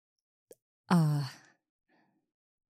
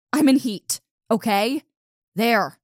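blurting it out in a loud, high burst; embarrassed yell; 4 semitones higher, 7 dB louder than usual. Acted. Wolf girl yells her confession at the human boy, embarrassed. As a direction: yell it to get it over with. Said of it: "I really didn't mean to yell at you".

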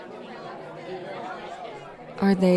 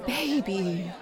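tender; 9 dB quieter than usual. Acted. Soft call to partner.

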